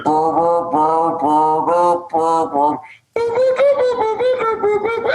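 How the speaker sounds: rumbling run of mouthed bass notes; imitated bass playing. Imitating a bandmate's playing, mouthed instrument noises. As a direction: burble it like a busy bass line. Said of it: "He's just over there".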